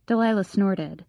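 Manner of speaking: ordinary delivery, nothing marked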